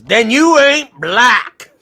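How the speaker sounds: loud, slow mock retort; chat quote. Streamer reading a chat comment aloud, loud slow mock retort. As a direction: bark it slow and loud like a know-it-all. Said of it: "The Taco comes in and goes".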